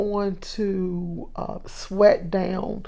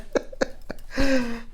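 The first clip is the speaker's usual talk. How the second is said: amused chuckle to oneself; real chuckle; 4 semitones higher than usual. Real, not acted. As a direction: chuckle at your own aside. Chuckles after joking about fried fish.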